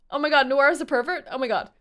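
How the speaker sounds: fast, loud mock outrage; scandalised. Real, not acted. Streamer joking the director is a pervert, fast scandalised outburst. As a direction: gasp it out in delighted horror.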